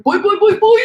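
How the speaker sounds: high and loud, pumped up; hyped yelling. Pretend. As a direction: shout it like a hype man. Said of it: "respond with".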